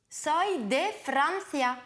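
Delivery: slow, clear model sentence for a learner to copy; Soy de Francia, slow and separated for repeating; slow model phrase. Shown as a demonstration. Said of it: "À toi de m'imiter ensuite". (phrase modèle lente, bien détachée)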